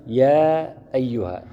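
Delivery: يا held long to show the hidden alif in "يا أيها"; long, slow يا before أيها; drawn-out يا. Shown as a demonstration. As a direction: stretch the يا of "يا أيها" slowly. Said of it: "بعد الياء ألف صغيرة".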